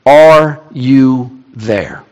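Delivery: very slow, word by word; drawn-out plea. Acted. Complaint many of us have asked of God, drawn-out plea. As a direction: stretch each word out into the silence. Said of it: "and it's this".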